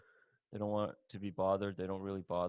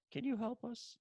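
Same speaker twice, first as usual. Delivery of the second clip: small, high-pitched begging voice; pleading mock appeal; 13 semitones higher, 4 dB quieter than usual. Quebec at Ontario's door after an invasion, high meek plea. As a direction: plead meekly in a much higher voice.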